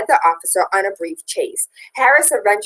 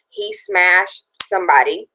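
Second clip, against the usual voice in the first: ordinary talk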